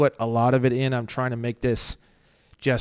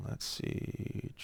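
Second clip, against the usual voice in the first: softer whisper, breath only; whispered to himself; 17 dB quieter than usual. Real, not acted. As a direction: whisper it under your breath. Muttering while reading a document.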